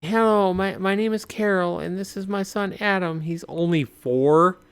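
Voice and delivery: pleading mother. Acted. Carol introducing her son at the door, high urgent voice. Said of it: "Woman's like".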